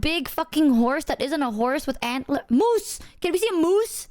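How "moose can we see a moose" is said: high, thrilled outburst; excited about a moose on the road trip; excited squeal. Real, not acted. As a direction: burst out at the idea of a moose.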